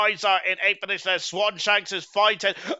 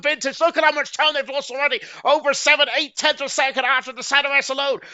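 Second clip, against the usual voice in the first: raised, energetic race calling; excited commentary; 7 semitones higher, 4 dB louder than usual. Real, not acted. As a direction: lift the energy as racing resumes. Race restarts, commentator hypes up.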